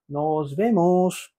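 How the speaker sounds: Nos vemos stretched out syllable by syllable; very slow model. Shown as a demonstration. Teacher drawing out Nos vemos for students to copy. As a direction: drag Nos vemos out for learners. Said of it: "따라해볼까요".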